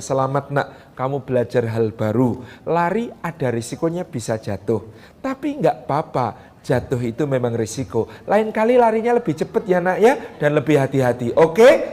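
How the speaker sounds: put-on soft, low, tender voice to a child; gentle praise to child. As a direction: speak gently like a proud mother. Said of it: "jabat tangannya".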